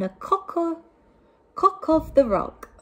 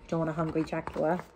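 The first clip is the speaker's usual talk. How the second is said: quick aside; 5 semitones lower, 7 dB quieter, much faster than usual. Real, not acted. Aside to children, quiet fast.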